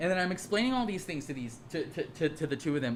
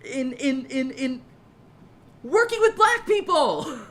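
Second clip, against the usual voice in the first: high, loud mock-lecturing voice, much higher; mocking condescending lecture; 10 semitones higher, 5 dB louder than usual. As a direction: lampoon a preachy know-it-all.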